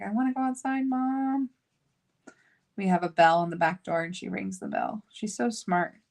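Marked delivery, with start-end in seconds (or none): 0.0-1.5 whining dog voice. Retold or imitated. Dog asking Mom to go outside, in a higher whine. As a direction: speak for a dog pleading to be let out. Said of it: "She's like".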